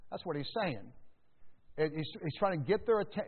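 normal talking voice

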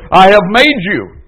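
high, loud and commanding; slowing, thundering divine voice; voice of God. Acted. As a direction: proclaim as God rebuking his people. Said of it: "Jehovah says".

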